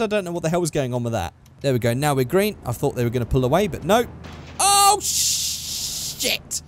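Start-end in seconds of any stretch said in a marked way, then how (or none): 4.6-6.6 high drawn-out yelp of dismay; startled high-pitched curse; alarmed swearing. Real, not acted. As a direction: blurt it out high and stretch it. Sudden trouble while driving in game.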